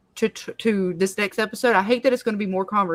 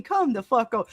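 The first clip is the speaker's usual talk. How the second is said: ordinary talk, nothing marked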